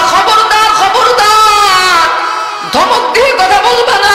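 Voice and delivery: high, long drawn-out cry of warning; stern warning shout. Acted. Preacher warns the congregation. (কড়া সতর্কবাণী, টেনে চিৎকার করে)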